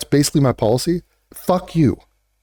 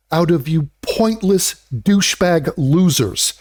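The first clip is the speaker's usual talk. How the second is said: usual speaking voice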